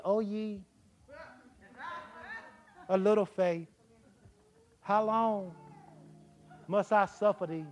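soft, drawn-out, long-suffering sigh with long pauses; soft pious sigh. Shown as a demonstration. Preacher showing how Jesus did not say it, soft and weary. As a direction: sigh it out wearily like a pious martyr. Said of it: "He didn't say it like that".

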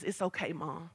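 imitating a soft, low calming voice; gentle soothing. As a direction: quietly settle mom down, as the daughter would. Daughter calming mother in story.

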